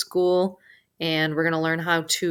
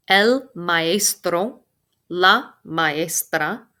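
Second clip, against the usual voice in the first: slowly and clearly, word by word; drawn-out teacher demo; slow careful demo for the class; slow model pronunciation; much slower than usual. Shown as a demonstration. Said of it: "Both of those words mean teacher".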